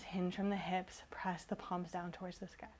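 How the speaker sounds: whispered cue with the wrong word; soft slip. Real, not acted. Teacher cues her class with a whispered slip of the tongue. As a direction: breathe it out softly, not noticing the mistake. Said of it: "I can't speak today".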